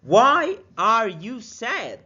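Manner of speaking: slow, high WHY leading the question; stressed why. Shown as a demonstration. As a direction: lean on WHY. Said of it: "teacher models a question". (سؤال بنبرة مرتفعة)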